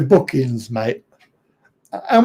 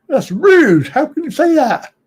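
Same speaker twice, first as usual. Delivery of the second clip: mock outrage; 10 semitones higher than usual. Mock offence on Australians' behalf.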